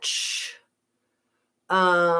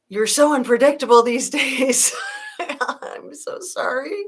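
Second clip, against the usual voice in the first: real laugh breaking into the line, higher and louder; 7 semitones higher, 5 dB louder than usual. Real, not acted. Streamer teases a viewer's comment, laughing. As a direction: tease the chat while laughing.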